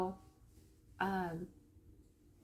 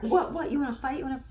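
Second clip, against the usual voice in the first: higher, louder mock challenge; mock angry; 3 semitones higher, 5 dB louder, faster than usual. Pranked guy wanting to fight the bush, mock angry. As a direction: imitate a guy picking a fight. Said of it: "why do they get angry".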